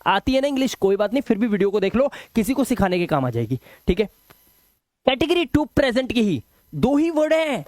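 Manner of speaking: ordinary talk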